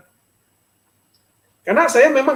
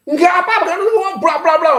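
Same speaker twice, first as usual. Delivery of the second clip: irritated retort; 9 semitones higher, faster than usual. Retold or imitated. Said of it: "Marah, jengkel saya".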